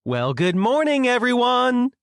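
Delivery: high, lilting chirpy greeting; overly perky tour-guide sing-song; cheerful sing-song. A narrator's reading. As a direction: sing it out, climbing to a long high note. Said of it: "skipped off the bus and sing-songed".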